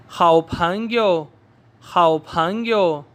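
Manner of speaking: 好朋友 stretched syllable by syllable; very slow drill. Shown as a demonstration. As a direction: draw 好朋友 out slowly. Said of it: "मेरे साथ फिर पढ़िए".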